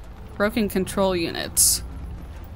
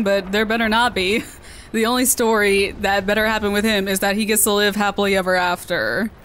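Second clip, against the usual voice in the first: usual speaking voice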